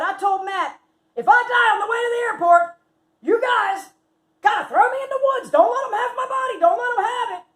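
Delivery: high, loud, frantic instructions; hammed-up dying orders. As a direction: imitate a friend hollering melodramatic last wishes. Friend gives comic dramatic dying orders.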